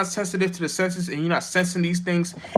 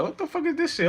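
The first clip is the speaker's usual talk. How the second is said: high, incredulous imitation of himself; baffled mock disbelief; 11 semitones higher, 5 dB quieter than usual. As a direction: act out being completely lost by the movie. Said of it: "I'm like".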